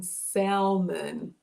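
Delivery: "salmon" with the L sounded; L pronounced. Retold or imitated. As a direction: say "salmon" with an audible L, SAL-mun. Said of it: "have the letter L pronounced".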